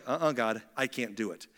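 low, soft, reluctant refusal; subdued loss of confidence; older Moses. Acted. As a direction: back out quietly, voice dropped. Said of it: "And now he's like".

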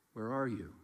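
soft caring where are you; tender concern. Shown as a demonstration. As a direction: ask gently like a worried father. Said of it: "There's love, there's concern".

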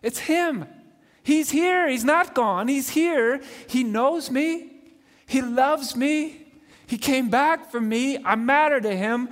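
she can't believe he's back; joyful disbelief. Acted. Mary's joy at recognizing Jesus.

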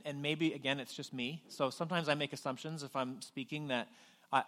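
ordinary talk, nothing marked

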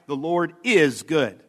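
slow the line, leaning on "is"; "is" stretched out as the strong word; stress on IS. Shown as a demonstration. Preacher reminding listeners of the emphasized word, stress on "is". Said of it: "we were emphasizing the word is".

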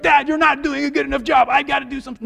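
put-on high, loud panicky child's voice; panicked kid. Son frightened in the river, high panicky whine. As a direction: whine like a scared little boy in water.

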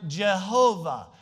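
Jehovah with an English J at the start; English vernacular pronunciation. Shown as a demonstration. Preacher giving the familiar English term, Jehovah with a hard J. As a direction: say Jehovah slowly, the everyday English way. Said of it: "we would say in our English vernacular".

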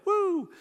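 a high, loud whoop of a cheer; mock cheer. Peter cheering Jesus on, whooping loudly. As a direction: whoop like a hyped-up fan. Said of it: "Peter's like".

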